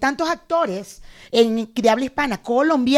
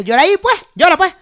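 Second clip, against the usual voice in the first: loud, higher mocking demand to cry; mock bossy demand; 7 semitones higher, 11 dB louder than usual. Mocking people who demand she cry on cue, loud and high. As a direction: bark it like a pushy stranger. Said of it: "siempre te dicen eso".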